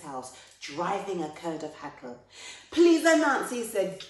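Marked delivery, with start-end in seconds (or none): 2.7-3.6 high, very loud appeal; urgent plea. Acted. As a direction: call out to a friend for help. Takuma begs Anansi for help.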